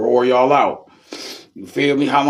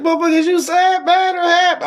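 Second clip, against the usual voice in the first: put-on very high, loud drunk question; hammed-up drunk, much higher and louder; mock drunk; 4 dB louder than usual. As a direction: squeal it like a wild drunk. Voicing a drunk in story.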